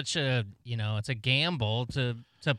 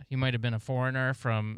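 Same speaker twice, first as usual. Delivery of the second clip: usual speaking voice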